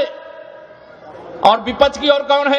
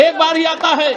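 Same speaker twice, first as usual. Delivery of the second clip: raised, projected rally cry; ringing repeat. Real, not acted. Speaker praising the prime minister as a rare leader, raised and ringing. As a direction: hurl the praise out to the crowd.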